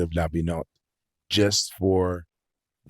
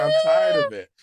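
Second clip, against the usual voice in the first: very high, loud exasperation; worked-up, high-pitched outburst; laughing protest; 6 dB louder than usual. Real, not acted. Repeats laughing outburst about swearing.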